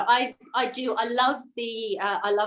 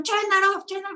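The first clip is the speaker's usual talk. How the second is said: a much higher, barked order to stop the alarms; commanding shout; 10 semitones higher, faster than usual. Retold or imitated. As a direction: yell it at the crew over the klaxons. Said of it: "he's going".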